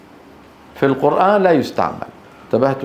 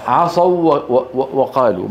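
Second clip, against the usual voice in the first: ordinary talk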